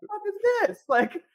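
high, laughing mock outrage at the trail; laughing dad impression. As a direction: giggle through the dad's cursing. Dad cursing on the ride, retold.

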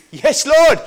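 high, loud, thrilled yes; delighted mock cheer to God; excited. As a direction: cheer it like a win. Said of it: "I get excited".

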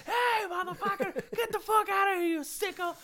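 very high-pitched mock shouting; retold outraged yell. Caught worker with pants down at his boss, high mock yell. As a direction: holler it high, chasing off an intruder. Scared guy yells at boss filming.

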